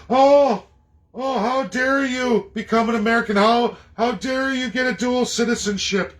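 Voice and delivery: high, loud, scandalised mock voice; mock outrage. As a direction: parody a shocked, prim objector. Mocks outraged Canadians' objection.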